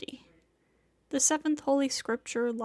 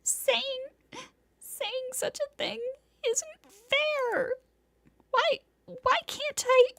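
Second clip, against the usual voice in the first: tearful outburst; 13 semitones higher, slower than usual. Acted. Senpai sobbing at Tono-kun, building to a shout. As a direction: cry it out, rising to a shout. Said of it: "shouts like a spoiled child".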